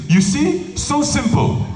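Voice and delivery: very high-pitched imitation of a satisfied guru; mock triumph. Guru shows the speaker how easy it is, mock triumph. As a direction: playfully pitch it way up, as if it's obvious. Guru's triumphant point, acted.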